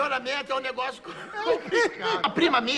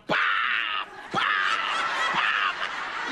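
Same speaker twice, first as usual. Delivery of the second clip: imitated slap sound; much slower than usual. Comic telling of the slat hitting her, explosive imitated whacks. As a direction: burst out each smack, spaced apart.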